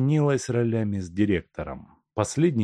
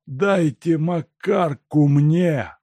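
slow, higher, loud demand of a madman; louder demand; 9 semitones higher, 7 dB louder, much slower than usual. A narrator's reading. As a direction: insist louder each time. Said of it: "произнёс больной громче".

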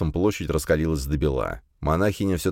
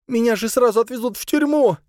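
panicked cry; 4 dB louder than usual. A narrator's reading. Sabrina panics about prison.

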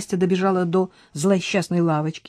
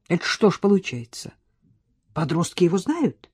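ordinary delivery, nothing marked

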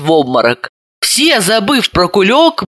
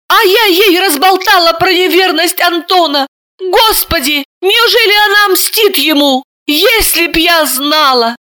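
high, dismayed outburst; horrified; 10 semitones higher than usual. Acted. Sister realizing she caused it, high and horrified. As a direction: clutch your head and blurt it out.

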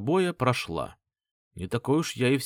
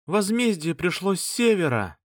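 shouting; 8 semitones higher than usual. Acted. Relative shouting to panic mercenaries.

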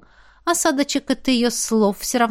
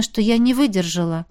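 ordinary delivery, nothing marked

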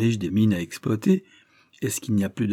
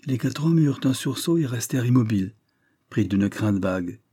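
plain reading voice

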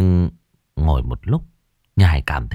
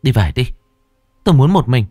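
loud, higher irritated command; raised voice; 5 semitones higher, 5 dB louder, faster than usual. A narrator's reading. Irritated mistress sending the maid away, loudly. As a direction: raise your voice and dismiss her. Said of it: "Nhài to tiếng".

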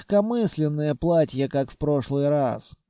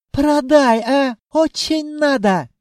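high, loud djinn wheedling; pleading djinn; 9 semitones higher, 6 dB louder than usual. A narrator's reading. Djinn begs the owner to sell, pleading. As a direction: beg loudly in a high accented voice.

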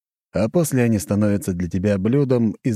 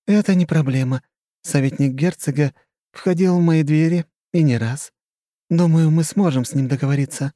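higher, scheming society lady; coy and calculating, higher; flirty countess; 8 semitones higher, 3 dB louder than usual. Acted. Khrushcheva's voice.

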